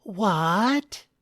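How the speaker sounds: high, shocked "What?"; startled gasp. Acted. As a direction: react in sudden surprise. Wife startled at the table.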